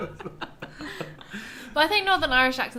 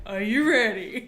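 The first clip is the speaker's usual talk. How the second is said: imitating the American take; slower than usual. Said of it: "I'm gonna have you being like".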